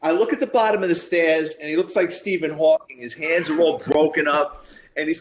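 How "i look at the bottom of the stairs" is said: ordinary delivery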